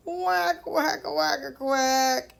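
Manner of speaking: snore out loud quacks like a duck; snoring duck quacks. Acted. Teacher voicing the duck snoring in the barn, loud quacks. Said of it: "snored the duck".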